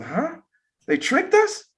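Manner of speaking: high-pitched stunned question, retold; shocked disbelief. Retelling a sister's reaction to the map, shocked. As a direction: imitate her shock, voice shooting up. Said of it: "she was shocked".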